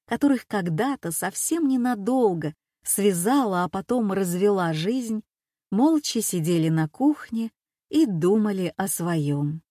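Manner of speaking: plain reading voice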